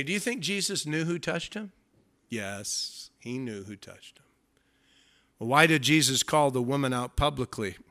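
ordinary talk

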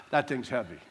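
low, pretend-winded comic aside; mock winded angel. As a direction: play an angel worn out from lifting. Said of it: "He probably went".

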